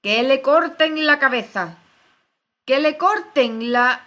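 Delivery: loud, high, drawn-out queen's shout; frenzied shouting. A narrator's reading. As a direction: scream the order in a fury. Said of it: "comenzó a gritar desaforadamente".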